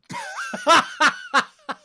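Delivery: loud high laughter bursting out; big burst of laughter. Real, not acted. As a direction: let a real laugh explode after the punchline. Laughing at own punchline.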